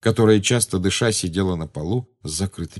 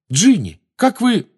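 high, loud, urgent call; exclaiming; 13 semitones higher, 7 dB louder, slower than usual. A narrator's reading. Harry rushes to his friends, exclaiming. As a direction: cry out to check on friends. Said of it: "хрипло воскликнул Гарри".

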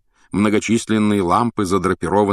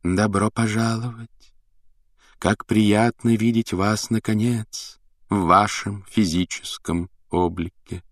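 медленный, приглушённый загадочный голос; протяжно, как из другого мира; неземной голос; 2 semitones higher, slower than usual. Acted. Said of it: "приглушённый, почти неземной голос". (Трелони приветствует класс приглушённым неземным голосом)

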